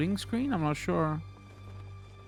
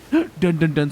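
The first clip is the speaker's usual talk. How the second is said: dramatic dun dun dun; 3 semitones higher, 9 dB louder, slower than usual. Pretend. Dramatic reveal sound effect.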